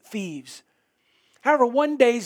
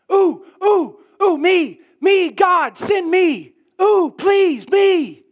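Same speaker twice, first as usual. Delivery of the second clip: high, loud, frantic ooh-ooh begging; eager kid desperate to be called on; Arnold Horshack impression; 9 semitones higher, 7 dB louder, slower than usual. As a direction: bounce in the seat begging to be picked. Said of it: "act like Arnold Horshack".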